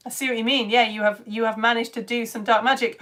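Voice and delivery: usual speaking voice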